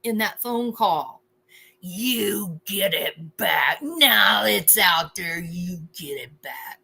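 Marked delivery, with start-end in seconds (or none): 1.8-6.8 slow, hammed-up tough guy threat; menacing threat. Mocking the over-the-top phone caller, slow menacing threat. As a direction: draw out a mock-menacing demand.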